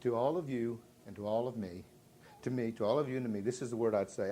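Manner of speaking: usual speaking voice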